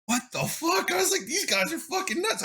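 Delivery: high-pitched, disbelieving outburst, retold; incredulous reaction. Viewer recalls watching a friend spend a thousand dollars, incredulous. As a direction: replay your stunned reaction, voice shooting way up.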